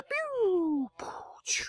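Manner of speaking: high, long "pew" fading into whispered puffs; lights-out sound effect. Retold or imitated. Host imitating the lights going out, mouthed sound effects. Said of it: "the lights go out".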